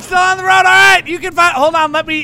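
Taylor Gray. Pretend. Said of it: "Oh, hey, Taylor".

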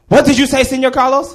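high, loud mock teacher demand; mad teacher. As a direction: imitate a teacher who has overheard you. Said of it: "And she was mad".

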